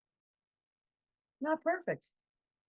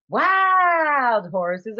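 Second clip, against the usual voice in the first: put-on Southern accent; 4 semitones higher, 7 dB louder than usual. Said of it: "her beautiful Southern accent".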